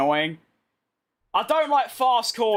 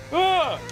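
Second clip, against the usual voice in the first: frustrated groan; 8 semitones higher than usual. Real, not acted. Frustrated by a green sector.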